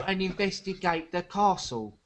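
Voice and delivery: mock a terrible film English accent; bad English accent. Mocking Keanu Reeves in Dracula to co-hosts, bad English accent.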